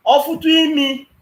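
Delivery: loud, high outburst on "Ọ fụtụ imi"; loud shout. Real, not acted. Caller shouting his threat at the panelist, loud. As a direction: shout the first half, then drop.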